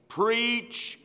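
very slow, loud Preach the word; Paul charging Timothy, slowly proclaimed; drawn-out proclamation. Acted. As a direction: stretch every word out. Climax of Paul's charge.

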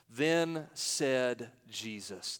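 very slow, each word drawn out; measured, emphatic verse reading. Shown as a demonstration. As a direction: read it out deliberately, word by word. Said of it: "Say those three words with me".

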